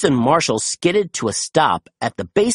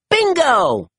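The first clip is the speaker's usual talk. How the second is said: high, triumphant exclamation; excited cry of discovery; exclaiming; 10 semitones higher than usual. A narrator's reading. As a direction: cry it out high, sliding down at the end. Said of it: "Chase exclaimed".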